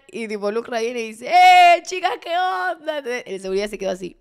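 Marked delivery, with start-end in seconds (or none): 1.3-3.0 loud, high, drawn-out hail; hearty greeting. Retold or imitated. The singer greeting the girls before security, hearty shout. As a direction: call out as if thrilled to see them.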